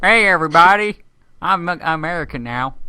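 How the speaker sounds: loud American greeting, then easing down; American accent. Shown as a demonstration. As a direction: announce yourself as a newly made American. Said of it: "I can do an American accent".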